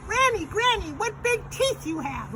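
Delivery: put-on very high, loud falsetto child voice. Shown as a demonstration. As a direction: shout it in a squeaky little-girl voice. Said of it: "do it a little bit louder".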